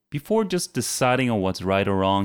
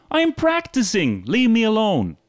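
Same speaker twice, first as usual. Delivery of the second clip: much higher mock indignant reply; mock annoyed retort; 12 semitones higher, 4 dB louder than usual. Telling off people who mock practice, mock annoyed. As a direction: tell them off playfully. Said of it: "then you tell them".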